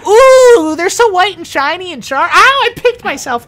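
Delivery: very high, loud gnome squeal of wonder; high-pitched little gnome, easily amazed; excitable gnome. Acted. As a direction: gush in a squeaky gnome voice, yelping at a prick. Gnome admires and pricks himself.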